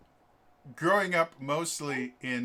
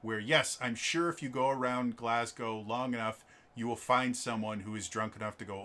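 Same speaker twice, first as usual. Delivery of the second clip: ordinary talk, nothing marked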